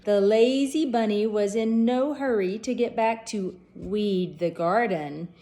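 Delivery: usual speaking voice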